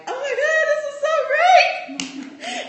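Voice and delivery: high, loud, slow laughing joy; emotionally excited. Retold or imitated. Recalling the coach's email, high loud excited gushing. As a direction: gush with teary excitement. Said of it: "I was emotionally excited".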